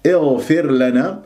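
اغفر begun with a clear i glottal onset; starting on hamza. Shown as a demonstration. Teacher showing how to begin the word, slow kasra onset. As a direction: recite اغفر لنا slowly from a fresh start. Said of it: "راح تكون مكسورة بهمزة قطع إذا بدأنا".

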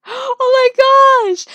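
high, loud, drawn-out gasp of amazement; loud, stretched, high-pitched amazement; amazed. Real, not acted. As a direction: squeal it slowly in astonishment. Sees self in spider mask.

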